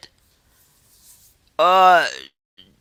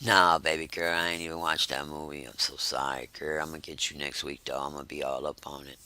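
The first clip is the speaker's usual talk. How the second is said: much lower and softer, a crooning mock apology; deep smooth man voice; 17 dB quieter than usual. Imitating Wes apologizing for skipping the movie, deep croon. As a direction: sink low and sweet-talk her like a smooth guy. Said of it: "I think he went to his deep".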